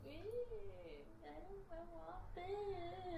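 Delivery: put-on high, soft, drawn-out valley-girl whine; whiny valley girl. Shown as a demonstration. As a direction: whine slowly like a pouting little kid. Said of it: "valley girl, just like".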